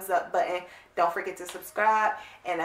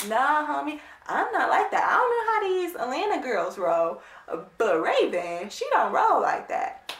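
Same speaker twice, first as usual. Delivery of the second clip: indignant; 6 semitones higher than usual. Real, not acted. Indignant about the Atlanta girls.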